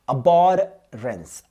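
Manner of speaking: abhorrence drawn out very slowly, syllable by syllable; slow dictation of abhorrence; slow teaching pronunciation. Shown as a demonstration. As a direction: stretch abhorrence out so students catch each sound. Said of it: "ध्यान दीजिए".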